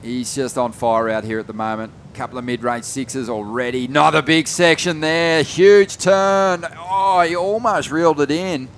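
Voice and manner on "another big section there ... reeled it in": high, loud live call; excited commentary. Real, not acted. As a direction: call the big turn with a lift. Live call of a big turn.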